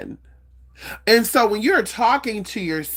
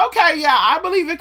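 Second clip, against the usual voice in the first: mock agreeing; 10 semitones higher, 3 dB louder than usual.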